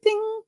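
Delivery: very high bright ding; a sudden high happy chime; cheery ding. Pretend. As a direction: chime like a switch flipping on. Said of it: "respond to a situation as happy".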